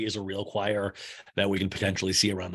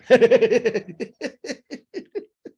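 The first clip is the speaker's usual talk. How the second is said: loud, high staccato cackle; big retold cackle; mock laugh; 14 semitones higher, 9 dB louder than usual. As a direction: burst into a high laugh that tapers off. Laughs in retelling the confessional.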